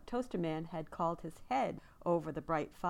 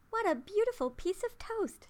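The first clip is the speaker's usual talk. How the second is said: put-on much higher, thrilled little girl; delighted cry; 6 dB louder than usual. A narrator's reading. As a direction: gasp with delight at the toast. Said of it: "she cried".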